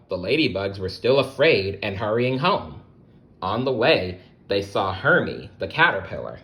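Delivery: usual speaking voice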